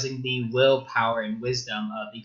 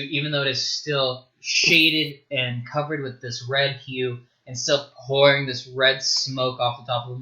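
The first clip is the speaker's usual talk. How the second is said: ordinary talk, nothing marked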